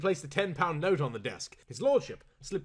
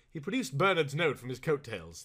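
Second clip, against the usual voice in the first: ordinary delivery, nothing marked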